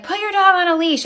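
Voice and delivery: high nagging mock voice; mocking the neighbor. Imitating the neighbor lady demanding a leash, high and nagging. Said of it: "and she's like".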